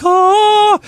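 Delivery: a high, squealing "Oh" of delight; mock delighted squeal. Sisters eyeing the Italian guys, a mock delighted squeal. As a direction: squeal it like a thrilled girl seeing the men.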